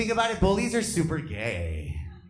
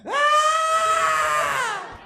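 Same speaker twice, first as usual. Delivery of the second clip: very high, loud, long shriek; scream on request; 6 dB louder than usual. Shown as a demonstration. Comic showing an audience member his fright, piercing scream. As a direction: repeat a full-out startled scream. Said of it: "He wants it again".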